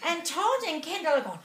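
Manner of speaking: ordinary talk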